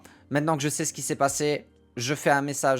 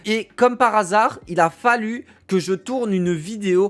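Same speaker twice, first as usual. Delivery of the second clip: higher, emphatic, exasperated storytelling; worked-up retelling; 5 semitones higher, 5 dB louder than usual. Retold or imitated. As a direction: tell the missed callback with rising disbelief. Retells the missed callback from Twitch.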